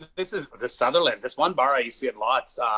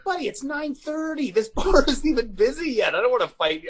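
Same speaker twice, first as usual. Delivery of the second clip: incredulous protest; 10 semitones higher than usual. Retold or imitated. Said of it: "I was like".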